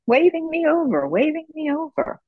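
high, gentle quoted patient voice; dying patient, lightly voiced. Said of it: "And she goes".

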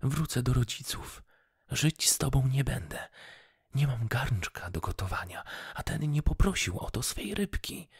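high-pitched indignant tirade; angry exclaiming. A narrator's reading. Wife threatening to leave her husband, angry outburst. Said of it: "wykrzyknęła kobieta".

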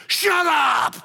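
mock-snap back at the stranger; mock retort. Fishermen snapping at unwanted advice.

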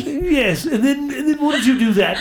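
high, hammed-up British accent through laughter; jokey fake British accent; mock British accent. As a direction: put on a silly posh British voice, teeth out. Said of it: "speaking in a British accent".

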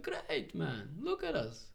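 high-pitched, imitated enthusiasm; retold delight. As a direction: act out a friend's delighted reply, pitched high. Retelling Bob's delighted reply.

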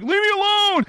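very high, wailed plea; hammed-up panicked protest; fleeing witness. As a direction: cry out while running away. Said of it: "And he's like".

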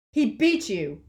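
loud defiant yell. A narrator's reading. Ron defying Voldemort, loud yell. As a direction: shout it back in defiance. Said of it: "yelled Ron".

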